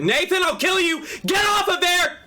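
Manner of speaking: furious yell at the cat; berserk rage. Real, not acted. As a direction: scream it at a pet on the desk. Yelling at cat on keyboard.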